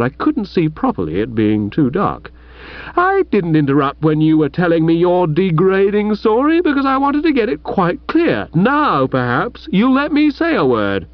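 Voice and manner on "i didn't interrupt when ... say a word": high, heated rebuke; indignant rebuke. Acted. Chuffy rebukes his old friend Bertie, heated and high. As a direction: dress him down from a moral height.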